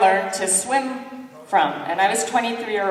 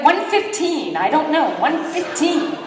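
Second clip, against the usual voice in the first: high, panicked blurt; high, nervous made-up answer; flustered guess; 7 semitones higher than usual. Retold or imitated. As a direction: grab any number to escape. Flustered guess under pressure.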